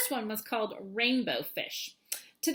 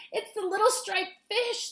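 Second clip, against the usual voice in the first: higher, anxious Rainbow Fish; worried; 9 semitones higher than usual. Acted. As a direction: sound worried for a friend. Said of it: "said Rainbow Fish".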